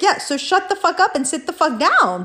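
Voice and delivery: high-pitched, exasperated telling-off; retold heated command; angry order.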